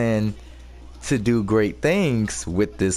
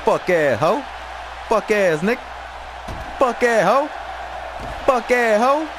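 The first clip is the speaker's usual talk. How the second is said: a higher, mocking taunt; higher mocking trash talk at the rival; 10 semitones higher than usual. Real, not acted. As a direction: taunt the opponent like a heel. Trash-talking the opponent in game.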